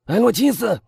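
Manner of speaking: high, loud repeated call; urgent shout. A narrator's reading. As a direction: call out urgently for your wife. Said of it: "他再喊". (急切地再次高喊)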